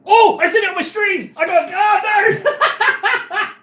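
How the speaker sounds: high outcry collapsing into laughter; real horrified laughing yelp; laughing dismay. Real, not acted. Streamer realises it went out on stream, laughing dismay.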